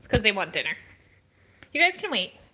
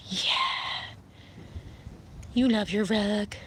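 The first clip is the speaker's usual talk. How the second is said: usual speaking voice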